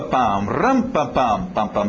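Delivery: ordinary talk, nothing marked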